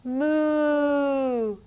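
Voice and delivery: slow playful moo; cow moo. Shown as a demonstration. Teacher mooing like a cow for a child in cat-cow. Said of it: "One more".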